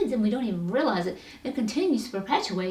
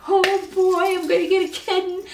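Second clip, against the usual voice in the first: much higher and louder, bubbling over with glee; excited cat lover; 10 semitones higher, 7 dB louder than usual. Retold or imitated. Cat lover imagining a new kitten, thrilled high squeal. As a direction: gush like a kid promised a kitten. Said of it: "I'm like".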